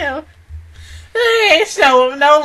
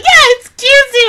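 high-pitched cutesy mock squeal; gushing approval; 12 semitones higher, 4 dB louder than usual. Imitating a reaction to a girl in the preview, gushing approval. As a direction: pitch it way up in playful, put-on delight. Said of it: "a girl in the preview is like".

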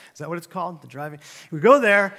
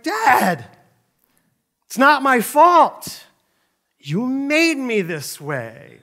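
loud, high, drawn-out whine of blame; mock-exasperated plea, stretching the words; frustrated kid protest; 6 semitones higher, 6 dB louder, much slower than usual. Kid striking out blames overcoaching dad, frustrated protest. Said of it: "You get really frustrated".

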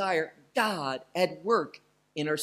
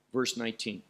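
usual speaking voice

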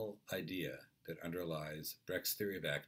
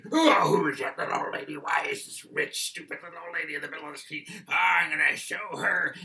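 loud, high, raging driver; really angry; 11 semitones higher, 13 dB louder than usual. Acted. Witness plays truck driver enraged at old lady, shouted rage. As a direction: rant and roar as the furious trucker. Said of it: "really, really angry".

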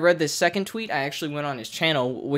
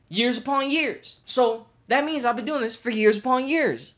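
mocking imitation; 7 semitones higher than usual. Mimicking the YouTuber's phrase.

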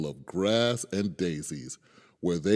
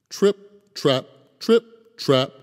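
light trip trap; 7 semitones higher, 6 dB louder, slower than usual. A narrator's reading. Bridge sound as small goat crosses.

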